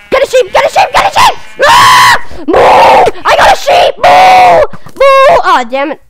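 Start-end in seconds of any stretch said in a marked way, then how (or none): 0.1-1.4 urgent chant climbing higher each time; frantic excitement. Real, not acted. Frantic while grabbing a sheep. 1.6-2.4 short, loud gamer scream; yelled scream. Real, not acted. Screaming during game action. 2.5-5.5 high, loud mock animal moos; triumphant mooing. Mooing triumphantly with stolen sheep.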